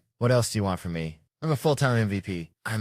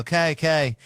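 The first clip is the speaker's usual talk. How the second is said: higher, louder mimicry of his question; imitating the man; 8 semitones higher, 5 dB louder than usual. Comedian retells the man staring at him, imitating. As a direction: retell his words in a raised voice. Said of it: "He go".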